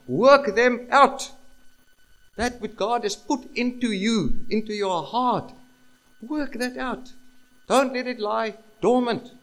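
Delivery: high, loud, urgent exhortation; full-force preaching. Real, not acted. Preacher urging believers to work it out, full-force preaching. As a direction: build to a ringing command and hold it there.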